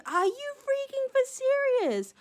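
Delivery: high, slow, incredulous delight; delighted disbelief. Real, not acted. Thanking a friend for a fantastic shell, incredulous. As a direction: stretch it out high in happy disbelief.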